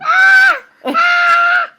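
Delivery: high squealing laugh; shrieking laugh. Real, not acted. Tickled, shrieks.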